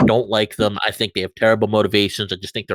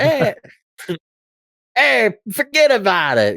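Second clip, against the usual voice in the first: boisterous, higher-pitched mock Brooklyn accent; 10 semitones higher, slower than usual. Shown as a demonstration. Showing friends the fun, annoying accent, boisterous Brooklyn accent. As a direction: put on an exaggerated, drawn-out Brooklyn accent. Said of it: "you're very loud and boisterous usually".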